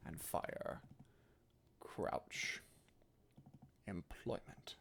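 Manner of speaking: soft, slow single words, some nearly whispered; quiet key words. Shown as a demonstration. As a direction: murmur each key word apart, under the breath. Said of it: "for the caveman exercise".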